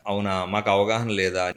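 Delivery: mock the accusation quietly; slow, dry sarcasm; sarcastic. Mocking the woman's claim.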